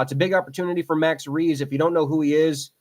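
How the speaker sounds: normal talking voice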